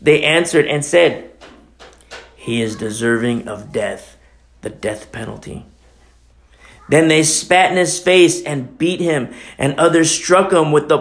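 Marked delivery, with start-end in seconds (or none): 2.3-5.6 low, hushed pronouncement; understated solemn judgment, dropping away; grave verdict. Acted. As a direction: hand down the sentence softly and low. Council pronounces the death sentence.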